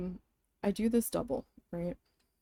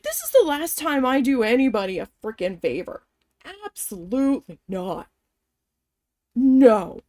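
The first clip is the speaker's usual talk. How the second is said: emphatic outburst ending in a loud "No"; angry refusal; 6 dB louder than usual. Retold or imitated. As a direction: refuse hard, punching the final word.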